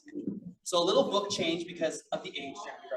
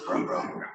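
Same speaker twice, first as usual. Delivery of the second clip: engine noise. Shown as a demonstration. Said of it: "make their own noises".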